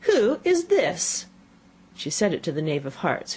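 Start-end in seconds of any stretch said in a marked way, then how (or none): 0.0-1.3 high, drawn-out queenly demand; stern question pitched up high and stretched out; severe. A narrator's reading. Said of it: "the Queen said severely".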